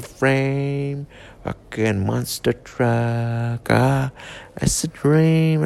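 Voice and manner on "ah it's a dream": normal talking voice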